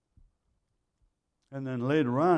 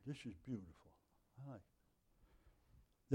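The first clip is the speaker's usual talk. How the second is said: whispered aside; 18 dB quieter, slower than usual. Real, not acted. Quiet aside while reading scripture.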